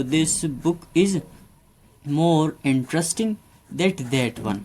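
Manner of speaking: very slow, word by word example sentence; slow dictation. Shown as a demonstration. Teacher dictating an example to students, very slow. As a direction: spell it out slowly for the class. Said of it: "अगर comparative में ले जाए".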